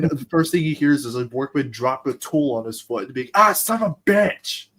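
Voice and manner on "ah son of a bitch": drawn-out, high-pitched cry of comic pain; mock pained yell. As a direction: curse loudly as if a tool hit your foot. Workman drops tool on foot.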